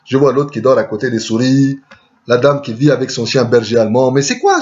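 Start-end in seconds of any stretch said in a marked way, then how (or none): 4.1-4.6 sudden high-pitched disbelief; baffled surprise. Retold or imitated. Newcomer shocked by Paris poverty, high baffled question. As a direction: jump up high, puzzled by what he sees. Bewildered by Paris street misery.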